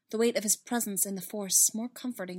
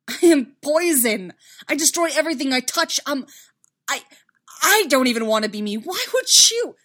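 anguished shouting, climbing to a high pitch; loud, desperate outburst; voice rising; 6 semitones higher, 12 dB louder than usual. A narrator's reading. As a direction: shout in self-loathing, building to a peak. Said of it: "his voice rising".

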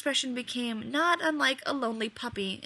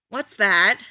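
suspicious; 11 dB louder than usual. A narrator's reading. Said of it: "Rodney asked suspiciously".

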